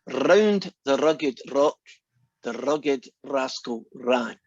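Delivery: very slow, rolled R on "Round", "rugged", "rascal", "ran". Shown as a demonstration. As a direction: roll the R's, drawn out as a demo. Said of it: "are you ready to get embarrassed".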